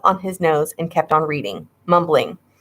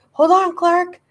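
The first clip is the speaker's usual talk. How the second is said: attempted shout; 12 semitones higher than usual. A narrator's reading. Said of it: "I tried to shout".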